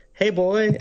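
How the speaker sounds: high, casual cartoon call; Homer. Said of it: "he just goes".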